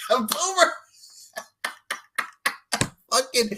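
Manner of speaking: high-pitched squeal through laughter; laughing exclamation. Real, not acted. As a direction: squeal it while cracking up. Cracking up at co-host's answer.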